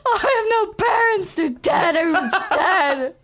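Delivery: high, loud hammed-up panic; mock hysterics. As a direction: wail it like a comic disaster call. Acting out a disaster phone call.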